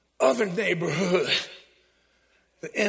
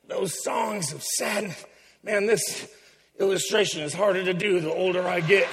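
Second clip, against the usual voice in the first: ordinary talk, nothing marked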